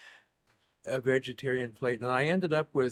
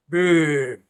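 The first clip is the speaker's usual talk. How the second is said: mock clerk voice; 2 semitones higher, 10 dB louder than usual. Retold phone call spelling.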